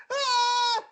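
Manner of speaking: high, loud shriek; girlish scream. Retold or imitated. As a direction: scream like a terrified girl. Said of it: "she starts screaming".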